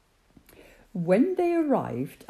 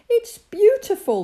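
higher, louder delighted shout; children shouting; 13 semitones higher, 9 dB louder than usual. A narrator's reading. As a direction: call it out like thrilled children. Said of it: "they shouted".

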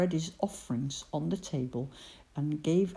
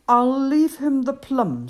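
Ben's voice; 7 semitones higher, 11 dB louder than usual. Acted. Ben's line, character voice.

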